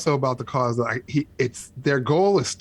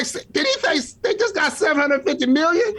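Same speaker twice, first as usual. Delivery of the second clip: high-pitched retold disbelief; incredulous, pitched way up; shocked; 9 semitones higher than usual. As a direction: re-enact your stunned double take. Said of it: "I was so shocked".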